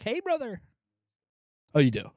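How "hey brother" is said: high, bright mock greeting; imitating Matt's greeting. Mimicking Matt's catchphrase, high mock greeting. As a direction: toss off a high buddy hello. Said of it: "Matt does it all the time. Like".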